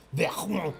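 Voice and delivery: VERMONT with a French throaty R; French pronunciation. Shown as a demonstration. Quiz host revealing the correct answer, French pronunciation of "Vermont". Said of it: "how to pronounce R's in French".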